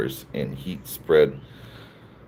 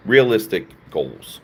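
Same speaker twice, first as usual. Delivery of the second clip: ordinary delivery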